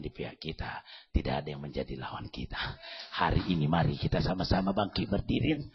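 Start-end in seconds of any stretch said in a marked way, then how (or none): 3.2-5.6 loud, fervent call to stand; loud altar call. Acted. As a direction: lift the whole room to its feet. Calls congregation to stand, pray. (ajakan berdoa yang lantang)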